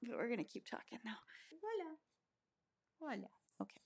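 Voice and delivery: fast, unvoiced whisper. Real, not acted. Whispering while feeding baby.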